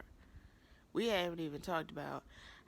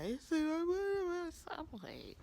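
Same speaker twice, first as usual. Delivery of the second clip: high exasperated squeal; 12 semitones higher, much slower than usual. Real, not acted. Vlogger to viewers, squealing high in disbelief. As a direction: pitch jumps way up in disbelief.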